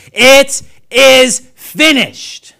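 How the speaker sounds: slow, high, very loud shout; screamed cry. Retold or imitated. Preacher voices Jesus' dying cry from the cross, screaming. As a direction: scream it out word by word. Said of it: "now he's screaming this".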